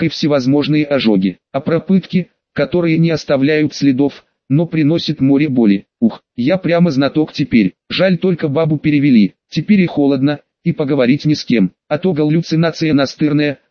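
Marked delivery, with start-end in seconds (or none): none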